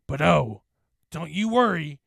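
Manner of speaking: loud, mocking false comfort; menacing taunt. A narrator's reading. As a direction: taunt your prey, leaning hard on the words. Specter taunts the narrator.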